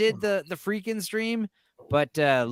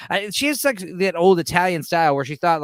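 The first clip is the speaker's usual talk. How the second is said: ordinary talk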